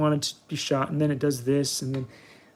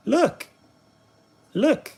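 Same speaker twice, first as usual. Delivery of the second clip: sing-song high look; 9 semitones higher than usual. Acted. Repeated Look, pitched up.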